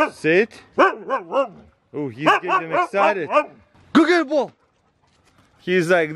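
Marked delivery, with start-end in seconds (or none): 3.9-4.5 high pet-talk command; high-pitched fetch call; sending the dog. Real, not acted. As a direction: send the dog off in a high, eager voice.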